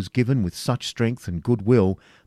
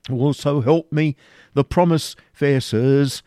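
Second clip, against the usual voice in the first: mildly alarmed, higher-pitched protest; 5 semitones higher than usual. A narrator's reading. Isaac denies the promise to his captors, alarmed. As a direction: protest with a touch of panic. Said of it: "even more alarmed than before".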